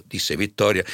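ordinary delivery, nothing marked

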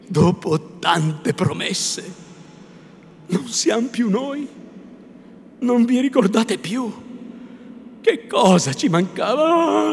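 loud, high, impassioned appeal; wounded reproach. A narrator's reading. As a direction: build from slow hurt to desperate protest.

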